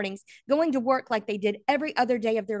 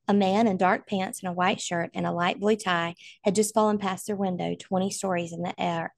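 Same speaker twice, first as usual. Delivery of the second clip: ordinary delivery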